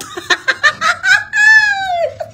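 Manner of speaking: high falling cackle; real helpless laughter; shrieking laugh. Real, not acted. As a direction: let the cackle roll down. Laughing at viewer reactions.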